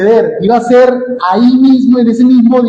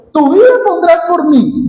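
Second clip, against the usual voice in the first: "Tu vida pondrás por mí" pitched much higher, a skeptical question; doubting tone; 10 semitones higher than usual. Shown as a demonstration. Jesus answering Pedro's boast, incredulous and high. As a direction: read it as if you can't believe him. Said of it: "Yo lo leo con este tono".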